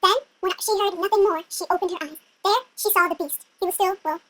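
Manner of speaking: plain reading voice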